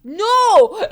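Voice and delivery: high loud protesting shout; an anguished shout of denial; real no. Real, not acted. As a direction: yell it at the page in protest. Reacting to Fyodor tearing paper.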